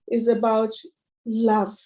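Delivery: ordinary delivery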